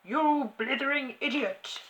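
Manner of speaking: loud, high insulting shout; furious high-pitched shout. A narrator's reading. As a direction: bellow it like a tyrannical headmistress. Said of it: "shouted the Trunchbull".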